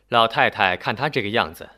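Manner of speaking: ordinary delivery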